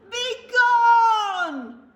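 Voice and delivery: very loud, high bellow; roaring bear. Acted. Bear roars at the mouse in his fridge. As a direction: roar the command. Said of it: "roared Bear".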